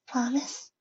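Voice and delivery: high, soft hopeful question; timid little voice asking; small filly voice. Acted.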